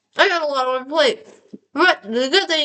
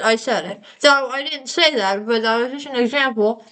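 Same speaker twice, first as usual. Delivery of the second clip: ordinary talk, nothing marked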